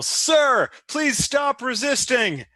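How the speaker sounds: high, slow mock command; in-character yell. Player's character orders an enemy to surrender, yelled. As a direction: call out a drawn-out order to a suspect.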